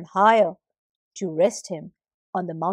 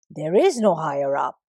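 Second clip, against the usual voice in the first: declared; 5 semitones lower, 3 dB louder than usual. Acted. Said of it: "declared the sky".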